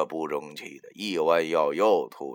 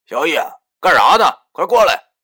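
loud and high call across the road; 10 semitones higher, 16 dB louder than usual. A narrator's reading. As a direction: shout him over from afar. Boss calling out to worker. (大声远处喊人)

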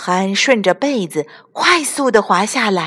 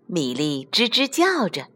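ordinary delivery

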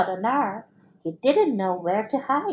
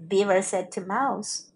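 usual speaking voice

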